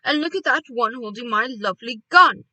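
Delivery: loud outburst without raising pitch; indignant shout. A narrator's reading. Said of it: "shouted Mister Gregg".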